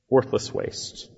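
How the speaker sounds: whispered repeat, "worthless" falling; hushed echo. Real, not acted. Preacher echoing himself to the congregation, whispered. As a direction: breathe the words "Worthless waste" as a whisper.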